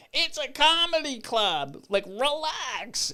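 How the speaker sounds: high, drawn-out heckler voice; hammed-up high-pitched call from the crowd; heckling audience lady. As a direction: mimic a lady calling across the room, stretching the words. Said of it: "this other lady from the rest".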